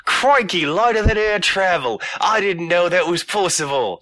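bad British accent gone Australian; mock British accent. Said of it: "that's the wrong accent".

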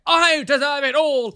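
high, very loud, drawn-out caricature; mock entitlement. Mocking an ex-friend's sense of entitlement, high and loud. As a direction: wail it grandly like a spoilt egotist.

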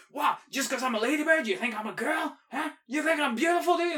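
high, indignant tough-guy snap; mock-offended gruff bug voice; gruff ladybird. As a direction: bristle like a little guy insulted. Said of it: "He's like".

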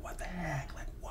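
whispered disbelief, voice dropped right off; retold whisper. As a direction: hush it in stunned disbelief. Retelling his shocked reaction.

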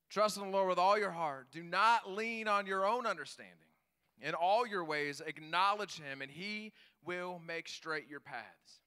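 loud proclaimed scripture, slowing at the end; preaching. Real, not acted. The pastor reads Proverbs to the church, preaching loud. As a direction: declare the verse to the church.

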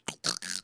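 mock struggle for air; low, soft strangled gasp; imitated choking gasp. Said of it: "he's trying to get up".

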